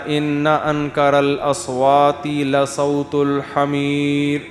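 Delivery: slow chanted Arabic recitation; Quranic recitation. Acted. Preacher reciting the verse to the congregation, slow chant. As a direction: recite the verse slowly and melodically.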